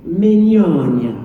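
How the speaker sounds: "Mignogna" said slowly as meen-YOHN-ya; careful, slow "Mignogna" with the gn as ny; Italian pronunciation. Shown as a demonstration. Host teaching fans his surname, slow Italian pronunciation. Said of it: "my name is Vic".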